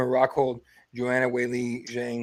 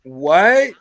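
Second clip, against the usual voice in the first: louder, stretched what; mock disbelief; 7 dB louder than usual. Replying to buddy about petition, mock disbelief. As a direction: play it baffled.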